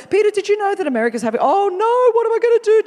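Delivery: high, loud, fast hammed-up panic; mock panicking God. Mocking how we imagine God panicking to Peter, hammed-up panic. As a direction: pretend God is losing it, rushing. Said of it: "we all act like".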